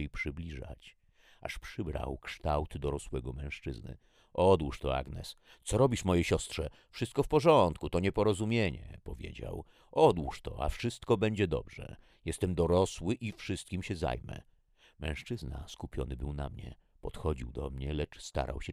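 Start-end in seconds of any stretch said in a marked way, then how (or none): 4.3-9.0 louder, rising high in pitch; urgent placating. A narrator's reading. Man tells Agnes to drop the gun, urgently placating. 9.9-14.4 loud command easing into high reassurance; mildly pressing, raised voice; insistent placating. A narrator's reading. Man promises Agnes he will handle it, insistent.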